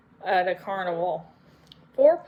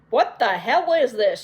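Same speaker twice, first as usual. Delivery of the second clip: louder, fierce demand; a read-aloud yell, not full force; mock yell; 2 semitones higher, 6 dB louder than usual. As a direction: bark it at the sisters. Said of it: "No need to yell".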